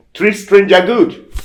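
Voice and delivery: high, loud, slow pronouncement; solemn command. Retold or imitated. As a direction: deliver it like a voice from a dream. Dream voice gives the command.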